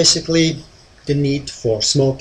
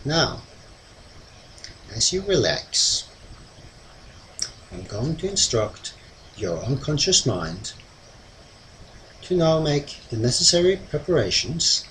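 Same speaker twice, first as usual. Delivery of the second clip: mesmerizing; 6 dB quieter than usual. Acted. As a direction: lull them into trance, long pauses. Hypnotist leads trance induction.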